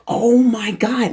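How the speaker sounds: slow, high exclamation of wonder; awestruck. Retold or imitated. Reliving mom answering after the meditation, awestruck. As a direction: stretch it out in amazement.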